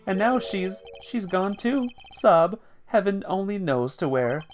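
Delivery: high, hammed-up grief; weepy old chairman. Old father laments his departed wife, high theatrical mock grief. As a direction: play mock-tearful mourning, sniffle and sob spoken as words.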